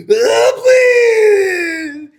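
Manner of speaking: high, loud crying shout; retold sobbing plea. Scared boy begging his mom for help, sobbing. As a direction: beg through mock sobs. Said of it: "I'm fucking crying".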